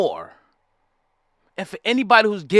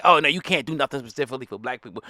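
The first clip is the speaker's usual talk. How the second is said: lower, softer, quick timid mocking; 7 semitones lower, 6 dB quieter, faster than usual. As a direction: mimic a meek excuse-maker. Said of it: "we gotta tap dance and say".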